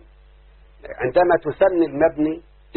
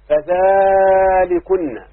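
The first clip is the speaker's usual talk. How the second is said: فذلكن stretched very slowly, higher and louder, every syllable held; drawn-out recitation; 4 semitones higher, 5 dB louder, much slower than usual. Shown as a demonstration. Teacher recites the Quran word to pupils, drawn out and loud. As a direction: chant فذلكن long and loud to mark distance.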